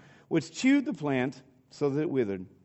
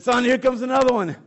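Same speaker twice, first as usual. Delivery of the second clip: put-on higher and louder, a dying old father milking it; hammed-up fake heart attack; 7 semitones higher, 6 dB louder than usual. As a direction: overact the dying dad, groaning for sympathy. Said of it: "His dad fakes a heart attack".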